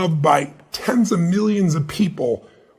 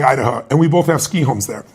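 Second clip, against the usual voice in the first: usual speaking voice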